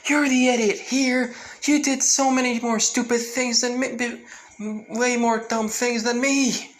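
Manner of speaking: high, loud bickering shout; yelling back. Retold or imitated. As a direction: yell back at your brother. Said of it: "Izuku just yelling back saying".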